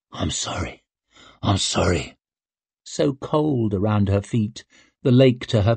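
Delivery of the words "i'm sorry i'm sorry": soft apology. Acted. Apology during ordeal.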